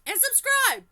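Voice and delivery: loud, high call to subscribe; shouted subscribe. Real, not acted. Youtuber yelling at viewers to subscribe. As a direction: belt it out to the viewers.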